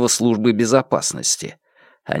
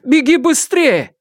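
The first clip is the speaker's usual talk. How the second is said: high, drawn-out anxious plea; worried urging; 3 dB louder, slower than usual. A narrator's reading. Mother urging son-in-law to flee, high worried voice. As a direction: urge him away, high and stretched out. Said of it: "озабоченно проговорила матушка".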